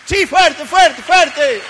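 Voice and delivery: rally the crowd loudly; loud urging. Real, not acted. Preacher urges the congregation to answer, loud shout. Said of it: "urging the congregation loudly".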